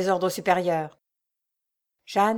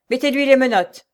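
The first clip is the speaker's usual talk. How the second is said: barked command, louder; loud order; 4 semitones higher, 8 dB louder than usual. A narrator's reading. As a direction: give the order sharply. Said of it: "ordonna-t-il". (ordre sec et fort)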